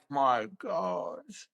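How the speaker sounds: imitating a stunned, deflated reaction; retold dismay. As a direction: sink into dismay, dragging out the words.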